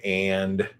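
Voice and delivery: "and," held long and level; drawn-out word. Shown as a demonstration. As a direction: drag out "and," slowly. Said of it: "drawing words out".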